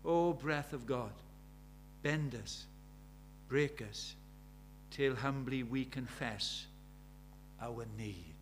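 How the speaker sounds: slow, soft recited prayer; measured plea, sinking low at the end; prayerful recitation. Acted. Preacher praying humbly to the breath of God, reverent.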